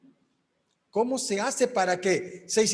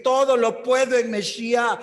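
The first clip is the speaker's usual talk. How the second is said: loud declaration; 2 semitones higher, 5 dB louder than usual. Shown as a demonstration. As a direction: proclaim it loudly, with faith. Declaring the verse against "no puedo. (proclamación en voz alta, con fe)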